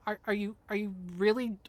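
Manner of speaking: ordinary talk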